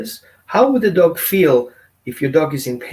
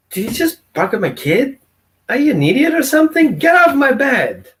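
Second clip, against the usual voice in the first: higher and louder, exasperated scolding; angry owner; 10 semitones higher, 5 dB louder than usual. Retold or imitated. Owner scolding the dog for growling at his kid, angry and loud. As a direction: bark it at the dog like an outraged owner. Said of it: "And you're like".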